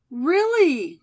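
squeal-like rising delight; delighted cry. A narrator's reading.

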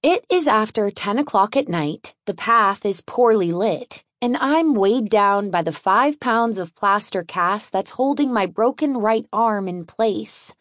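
ordinary delivery

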